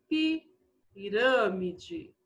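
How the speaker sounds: stretched out syllable by syllable; slow dictation. Shown as a demonstration. Teacher giving pupils a long example word, slowly dictated. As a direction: dictate slowly so they can write.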